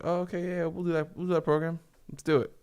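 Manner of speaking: high-pitched, eager-to-agree imitation; mock pushover giving in; easily persuaded customer. Acts out people folding easily.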